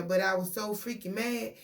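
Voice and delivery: ordinary delivery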